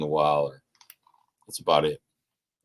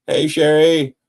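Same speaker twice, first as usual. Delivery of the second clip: calling out; 8 semitones higher, 9 dB louder, slower than usual. Retold or imitated. Kid calling out to manager.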